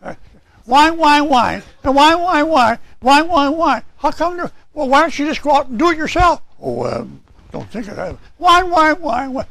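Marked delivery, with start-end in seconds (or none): 0.6-3.8 high, loud mock whine; whining imitation. Preacher imitating a whiner to the church, high mock whine. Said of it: "That's like a whiner". 8.4-9.5 high mock whine; whining imitation. Preacher imitating a whiner to the church, high mock whine. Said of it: "Some people want to be whiners".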